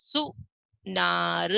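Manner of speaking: सुनार stretched slowly; slow first take. Shown as a demonstration. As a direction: sound out सुनार. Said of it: "इनको हम पढ़ेंगे।".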